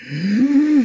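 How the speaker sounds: a long hum pitched high and loud; sustained high-breath hum demo. Shown as a demonstration. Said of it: "長くていいですから、「うーん」って言った時に".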